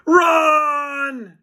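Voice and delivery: loud, high panicked yell; cried out. Acted. George yelling at Harold to flee the furious crowd, loud. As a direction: shout the warning in panic. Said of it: "cried George".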